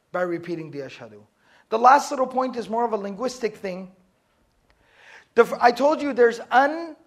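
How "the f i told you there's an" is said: ordinary delivery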